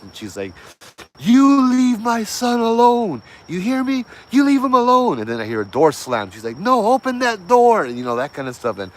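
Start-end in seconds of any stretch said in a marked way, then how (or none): 1.2-5.2 high, loud, slow yelled warning; furious shout. Retold or imitated. Mother yelling at the entity. 6.6-7.9 high, loud panicked shout; desperate yell. Retold or imitated. Mother yelling at the door.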